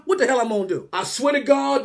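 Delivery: ordinary delivery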